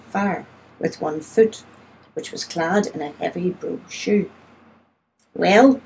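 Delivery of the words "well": sharp, louder one-word challenge; snapped. A narrator's reading. Lady confronting an unwelcome caller, snapping. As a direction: snap it with raised volume. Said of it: "she snapped".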